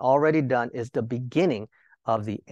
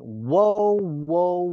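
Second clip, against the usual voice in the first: high, loud wailing cry of a warning eagle; eagle's loud cry; 5 semitones higher, 5 dB louder than usual. Acted. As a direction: cry it out like a giant eagle overhead. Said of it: "a loud voice".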